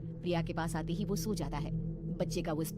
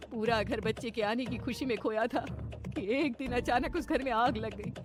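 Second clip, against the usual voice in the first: grave mother-in-law; 5 semitones higher, 5 dB louder than usual. Acted. Mamta telling bahu about the dead neighbours, anxious. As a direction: reveal a horror, rising to loud alarm.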